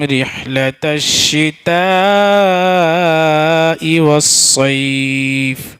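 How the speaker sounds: slow melodic tajweed recitation; Quran recitation. Acted. Teacher reciting the verse to students in slow melodic chant.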